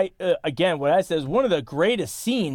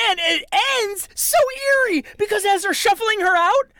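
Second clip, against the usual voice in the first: high-pitched, gushing excitement; thrilled; 4 dB louder than usual. Real, not acted. Film fan telling co-host about an eerie scene, thrilled. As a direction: get carried away, voice shooting up.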